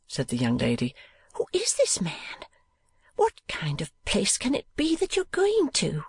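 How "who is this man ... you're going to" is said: anxious sister. Acted. Sister asking about her brother's new post, high worried voice.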